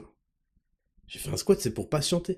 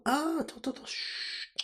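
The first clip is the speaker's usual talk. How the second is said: whisper, straining to listen; hushed, ear cocked; 9 semitones higher, 13 dB quieter than usual. Acted. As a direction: shush everyone softly. Hushing to listen.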